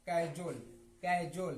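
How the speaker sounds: low-pitched misreading कैजोल, starting 'kai'; wrong pronunciation. Retold or imitated. Teacher shows what some people read, wrong pronunciation. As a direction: say कैजोल as 'KAE-jol', open first vowel. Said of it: "ये गलत है".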